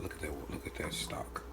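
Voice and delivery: whispered, awed look at that stock; softly whispered admiration of the broth; admiring whisper. Real, not acted. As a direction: breathe it out in wonder. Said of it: "admiring the stock".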